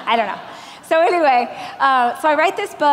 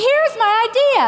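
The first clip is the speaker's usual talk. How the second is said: high, loud naive eagerness; bright mock eager pitch, much higher; 11 semitones higher, 5 dB louder than usual. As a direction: blurt it like a hopeful country girl. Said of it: "So I was just like".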